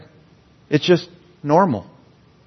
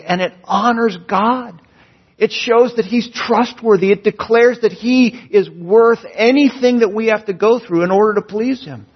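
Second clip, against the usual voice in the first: builds higher and louder into fervent preaching; rising fervor; 6 semitones higher, 3 dB louder than usual. Real, not acted. Preacher lifting the congregation toward praise, higher and louder.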